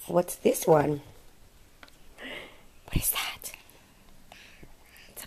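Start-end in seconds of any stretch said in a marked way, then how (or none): 2.9-5.3 hushed question; whisper. Real, not acted. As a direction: whisper it like a secret.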